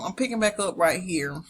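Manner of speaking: normal talking voice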